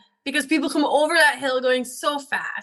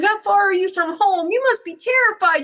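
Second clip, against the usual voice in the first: very high, fussing pet-talk; weird talking-to-a-dog voice; 10 semitones higher than usual. Retold or imitated. Woman offers a lost dog snacks, weird high pet-talk. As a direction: coax a stray dog in a raised, doting voice. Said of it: "talking to it like weird".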